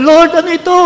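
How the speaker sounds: loud, high crying out to the Lord. Retold or imitated. Pastor retelling how he cried to the Lord, loud and high. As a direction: cry out to God in distress. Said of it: "umiyak ako sa Panginoon".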